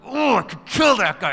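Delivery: high, loud mock fury; so mad. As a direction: fume theatrically at the other driver. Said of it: "and I'm so mad".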